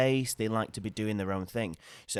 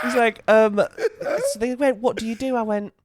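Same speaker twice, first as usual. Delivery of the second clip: laughing; 13 semitones higher, 9 dB louder than usual. Real, not acted. Laughing while retelling.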